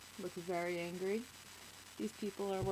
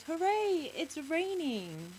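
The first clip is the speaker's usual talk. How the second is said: high, loud cheer, drawn out at the end; excited little boy; 8 semitones higher, 4 dB louder, much slower than usual. A narrator's reading. Boy cheering that rain has come, excited. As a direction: cheer like a delighted small boy. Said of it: "the little boy says".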